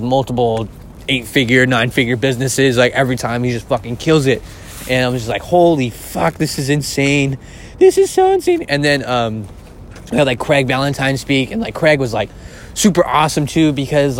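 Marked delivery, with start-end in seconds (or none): 5.4-8.6 rising into a squeal of disbelief on the last line; mock-stunned gushing; amazed exclamation. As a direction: replay the moment it blew your mind. Retelling being amazed by speaker.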